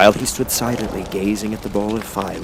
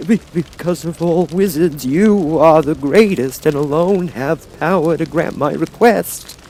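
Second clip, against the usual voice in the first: Lion pleading; 9 semitones higher, 6 dB louder than usual. Acted. Lion answers Oz.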